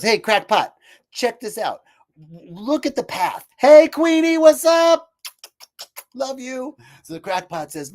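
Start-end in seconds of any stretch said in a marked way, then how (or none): 0.0-3.5 higher, bright invitation; lightly voiced friendly worker calling out; cheerful water carrier. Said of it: "the water carrier goes". 3.6-6.8 affectionate pet greeting. Real, not acted. Greeting a pet mid-talk.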